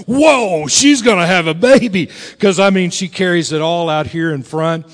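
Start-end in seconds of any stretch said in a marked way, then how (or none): 0.1-2.1 loud, astonished whoa; amazed reaction. Acted. Onlooker sees pregnant wife turn sideways, astonished. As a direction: burst out in mock amazement. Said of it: "She turns sideways and you're like".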